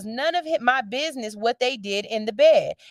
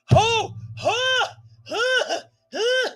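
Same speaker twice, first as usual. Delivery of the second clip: mock startled husband, much higher; confused husband; 10 semitones higher, much slower than usual. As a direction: imitate a groggy man jolted awake. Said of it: "he gotta be like".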